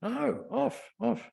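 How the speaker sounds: put-on high, insistent little girl; child's demand. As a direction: quote a toddler's protest. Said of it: "I say".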